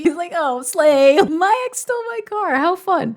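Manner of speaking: high, loud, hammed-up sarcastic voice; mock dramatic Harrison. Reader mocking Harrison's dramatic sarcasm, high and hammed-up. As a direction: camp it up, woe is me.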